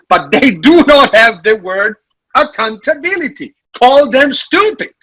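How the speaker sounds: high, animated, mock-outraged; mock indignation. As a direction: play up the outrage, half joking. Mock outrage about missing word.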